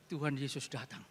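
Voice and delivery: ordinary delivery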